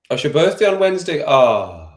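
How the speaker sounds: high, loud, quick delighted surprise; light up at the news; surprised. Real, not acted. Streamer reads that Mandy's birthday is Wednesday, surprised.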